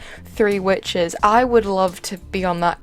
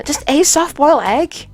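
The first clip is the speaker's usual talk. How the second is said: incredulous; 7 semitones higher, 4 dB louder than usual. Real, not acted. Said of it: "incredulous question".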